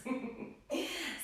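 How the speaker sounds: short high giggle; little amused laugh; light giggle. Real, not acted. Amused after sleepy class greeting.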